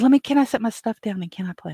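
high, quick eager pleading; imitating the young guest's excited asking; eager young man. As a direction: rush it out, dying to play.

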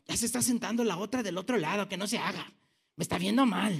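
low, quick, softened gripe; annoyed grumbling. Acted. Churchgoer griping privately about someone across the aisle, annoyed grumble. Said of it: "si tú estás enojado con alguien aquí".

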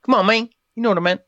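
high, exasperated plea; exasperated protest. Real, not acted. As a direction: protest the teasing. Teased over a mispronunciation.

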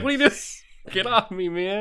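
a lightly voiced alarmed pal; alarmed friend. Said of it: "You're like".

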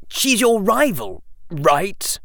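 put-on a sly young boy plotting against a rival; Draco voice.

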